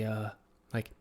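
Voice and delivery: usual speaking voice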